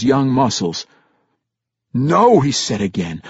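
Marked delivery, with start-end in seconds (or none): 1.9-2.4 raised, firm refusal; higher-pitched, emphatic denial; forceful. A narrator's reading. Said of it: "more forcefully".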